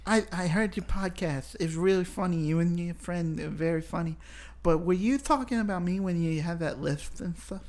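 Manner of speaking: voz aguda e meio ceceada de Mike Tyson; Mike Tyson impression. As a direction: uma imitação leve e exagerada do Tyson. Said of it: "That's what Mike Tyson's gonna say to you".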